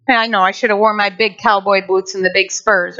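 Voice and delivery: ordinary talk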